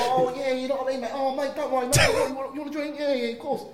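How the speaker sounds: high, loud Essex geezer impression; Essex accent. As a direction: ham up a chummy Essex bloke offering drinks. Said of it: "he's like".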